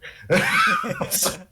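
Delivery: high squeaky nervous giggle; nervous laugh. Real, not acted. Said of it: "that's nervous laughter".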